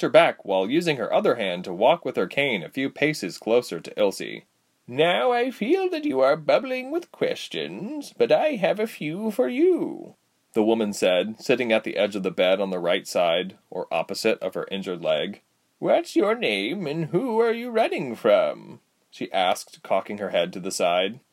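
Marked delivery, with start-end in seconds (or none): 4.9-10.1 put-on high, slow, swooping old lady voice; cheery aged healer, pitched up high; cheerful old woman. A narrator's reading. 15.8-18.8 put-on high, slow old lady question; prying old woman. A narrator's reading.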